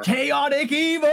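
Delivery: sudden high excited yell that clips the mic; excited shout. Real, not acted. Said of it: "I just got excited".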